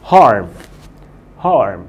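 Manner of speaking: Harm said clearly for the class to repeat; teacher modelling. Shown as a demonstration. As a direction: model the word Harm slowly. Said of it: "ردد ورايا".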